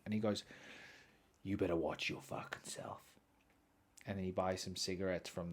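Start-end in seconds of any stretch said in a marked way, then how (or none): 1.4-3.1 low, hushed threat; menacing laborer. Retold or imitated. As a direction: imitate a tough guy threatening under his breath.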